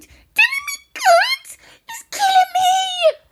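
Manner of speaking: slow, drawn-out, high shriek; old woman screaming. A narrator's reading. Grandma protesting the medicine to George, screaming shrilly. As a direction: scream it with full outrage, stretching the words. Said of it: "she screamed".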